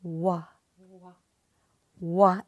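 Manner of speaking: "Wa" sung out from the navel, lips only; "Wa" chanted open, no tongue; droplet wa. Shown as a demonstration. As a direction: ring out "Wa" like a drop of water hitting a bowl. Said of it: "that water sound of a drop".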